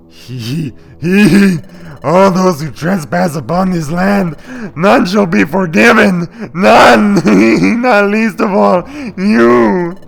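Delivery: high, loud cackling villain, drawn out; slow, theatrical curse in a put-on voice; Igor voice. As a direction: ham it up as a menacing spirit. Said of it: "my Engine or Igor voice from Young Frankenstein".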